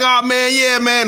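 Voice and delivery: a high, lightly voiced imitation of a casual guy; imitating the brother. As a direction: toss off a friendly "oh man" in his voice. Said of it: "So he's like".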